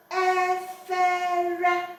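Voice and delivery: EFERE drawn out on high, level tones; correct Igbo tones. Shown as a demonstration. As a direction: sustain each syllable of EFERE high and even, loudly. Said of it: "the tones must be correct".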